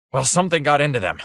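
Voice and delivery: a touch of panting, quick; hurried words, lightly winded; out of breath. Acted. C, winded after the fight, a hint of panting. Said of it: "still breathing hard".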